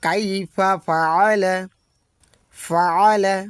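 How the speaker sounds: ফাআলা with a strong throat ain between the a's; ain sounded. Shown as a demonstration. Teacher contrasts ফাআলা with the wrong ফালা. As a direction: push ফাআলা from the middle of the throat. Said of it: "পড়ে ফালা".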